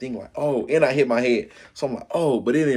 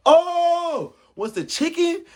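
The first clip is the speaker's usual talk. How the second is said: high, slow pained yell; retold yell; 4 dB louder, slower than usual. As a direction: yell it out through the pain. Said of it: "I was yelling".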